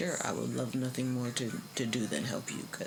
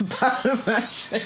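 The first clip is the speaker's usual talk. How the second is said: laughing through the words, high and loud; laughing goofily; 9 semitones higher, 8 dB louder than usual. Real, not acted. Vlogger laughing at her own goofiness, loud laugh. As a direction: let the laugh spill over the words. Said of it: "Just being goofy a little bit".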